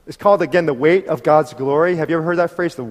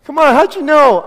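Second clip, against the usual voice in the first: much higher, louder, slow amazed cry; amazed driver; 11 semitones higher, 9 dB louder than usual. Retold or imitated. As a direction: stretch it out in disbelief. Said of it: "And then he goes".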